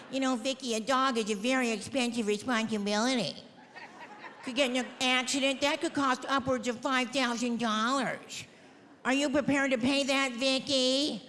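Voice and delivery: lightly put-on patronizing shelter worker; shelter staff. Shelter worker warning Vicky about the cost of a dog, patronizing. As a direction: imitate officious staff cautioning an adopter. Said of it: "No, they're like".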